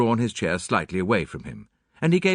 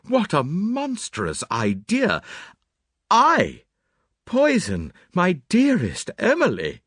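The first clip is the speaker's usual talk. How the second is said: outraged protest; 9 semitones higher, 4 dB louder, slower than usual. Acted. Suspect protests his innocence.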